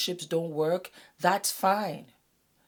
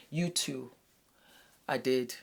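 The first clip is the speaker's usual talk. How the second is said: ordinary delivery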